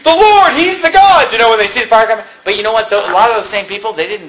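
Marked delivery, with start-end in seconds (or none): 0.0-1.3 high, loud cry of awe; worshipful cry. Retold or imitated. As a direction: cry out as the fire falls. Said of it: "falling on their faces saying".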